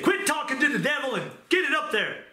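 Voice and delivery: high, scolding mock yell; imitating an abusive boss yelling orders; retold yelling. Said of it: "yell and abuse me".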